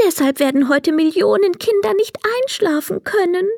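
high fairy voice, growing worried; wish fairy voice. A narrator's reading. Wish fairy explains to Emil, high and a little worried. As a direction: explain your powers like a tiny fairy.

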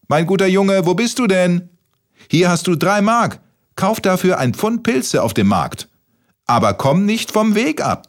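high singsong calling granny voice; grandma calling out. A narrator's reading. Grandma to grandson from the kitchen, high, fond calling. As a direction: call the boy from another room, pitched well up. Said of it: "rief seine gute Oma aus der Küche".

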